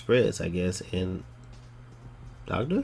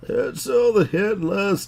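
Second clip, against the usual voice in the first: put-on high, slow, loud old lady voice; creaky granny reading the news slowly; old people voice; 11 semitones higher, 6 dB louder, slower than usual. Shown as a demonstration. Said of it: "my old people voice".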